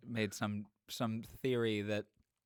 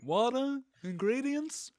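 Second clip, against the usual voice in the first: high, whiny stand-up bit; Jerry Seinfeld; 11 semitones higher than usual. Acted. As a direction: puzzle over it like an observational comic. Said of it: "that's a Jerry Seinfeld joke".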